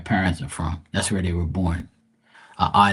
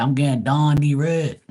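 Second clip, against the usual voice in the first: slow, higher, broad Bermudian "down the road"; Bermudian accent; 5 semitones higher, 4 dB louder than usual. Shown as a demonstration. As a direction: exaggerate the Bermudian vowels, drawn out and high. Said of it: "they say".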